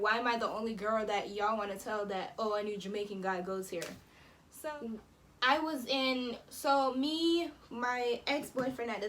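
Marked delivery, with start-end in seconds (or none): none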